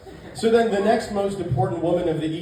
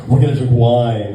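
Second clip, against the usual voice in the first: insistent imitation; 5 semitones lower, 9 dB louder than usual. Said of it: "She's like".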